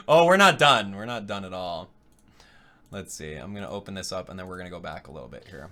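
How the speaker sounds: ordinary delivery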